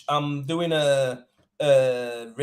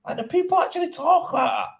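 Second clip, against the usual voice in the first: much higher-pitched, astonished question; mounting incredulity; 11 semitones higher than usual. Retold or imitated. Tradesman repeating his stunned thought about the message, highly incredulous. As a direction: push the disbelief right up high. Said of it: "thinking to myself".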